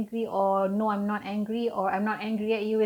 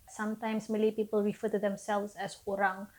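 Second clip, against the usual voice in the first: ordinary talk, nothing marked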